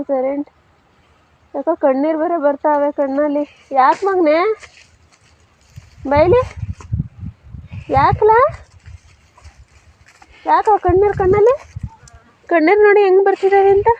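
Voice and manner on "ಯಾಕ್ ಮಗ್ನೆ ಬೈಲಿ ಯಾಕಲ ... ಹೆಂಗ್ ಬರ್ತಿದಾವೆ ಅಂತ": high, sing-song coaxing to a pet. Real, not acted. Woman coaxing her tearful cow Lakshmi, high coaxing baby talk.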